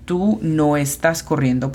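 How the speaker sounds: ordinary talk, nothing marked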